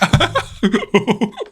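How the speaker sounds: high, silly imitated laugh; goofy laugh. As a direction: laugh like a dopey cartoon. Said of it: "Just picture the goofy laugh".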